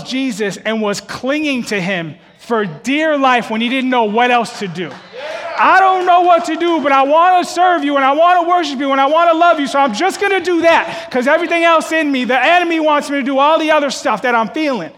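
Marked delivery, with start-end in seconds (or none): 5.6-14.9 loud, high, fervent cry to God; voicing a friend who prays aloud instead of fighting; shouted prayer. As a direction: pour it out at full voice. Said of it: "speaking to God at the top of his lungs".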